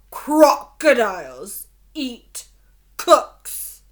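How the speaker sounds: slow, loud and menacing; deep loud voice. A narrator's reading. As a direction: lean in and say it like a threat. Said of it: "In a deep, loud voice".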